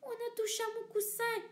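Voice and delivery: high cry of an upset mama bear. Acted. Mama bear finds her cushion touched, high cry. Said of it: "cria maman ours avec sa moyenne voix".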